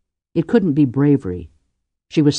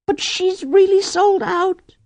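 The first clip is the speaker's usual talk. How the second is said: tearful; slower than usual. Acted. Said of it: "who was crying quite silently".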